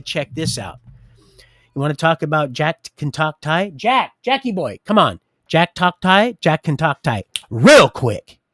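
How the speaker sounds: loud, cocky challenge, near yelling; brash shouted taunt; bragging shout. Retold or imitated. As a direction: call him out loudly, swaggering.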